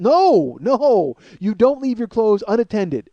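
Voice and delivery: emphatic, scandalized refusal; indignant outburst. Retold or imitated. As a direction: put your foot down, drawing out the words. Acted outburst at his wife.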